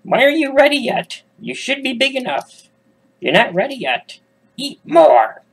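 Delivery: put-on high witch scolding the caged children. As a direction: screech it like an impatient witch. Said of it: "and says".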